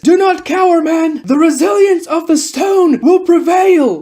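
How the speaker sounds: high, loud captain's battle speech; rallying shout. Acted. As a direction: rouse frightened troops with a loud cry. Captain rallies his scared platoon.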